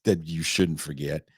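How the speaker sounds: ordinary talk, nothing marked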